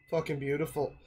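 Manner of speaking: normal talking voice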